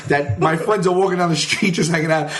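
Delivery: ordinary delivery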